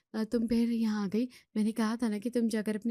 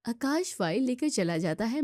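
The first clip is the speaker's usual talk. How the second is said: ordinary delivery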